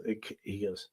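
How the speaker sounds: normal talking voice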